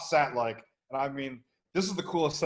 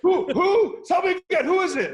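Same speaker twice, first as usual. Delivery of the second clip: high, loud frantic shout; 9 dB louder than usual. Acted. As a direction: scream it in the war zone. Acting the scene, shouting.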